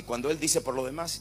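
ordinary delivery

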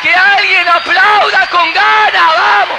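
high, loud roaring preaching; preaching at full force. Acted. Pastor calling the dead to rise, shouting. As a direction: shout the resurrection call over the room.

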